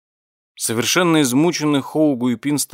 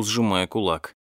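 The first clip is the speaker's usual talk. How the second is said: ordinary delivery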